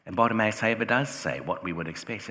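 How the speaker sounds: ordinary delivery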